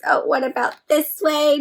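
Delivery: ordinary talk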